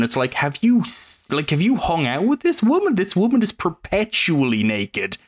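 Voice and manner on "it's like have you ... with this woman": ordinary talk, nothing marked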